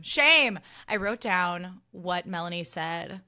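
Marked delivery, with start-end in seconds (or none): 0.0-0.6 emphatic, scolding outburst of "Shame"; loud condemnation. Real, not acted. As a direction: SHAME cried out high and loud. Condemning a betrayal, repeated louder.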